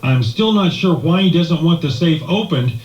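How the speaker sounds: ordinary talk, nothing marked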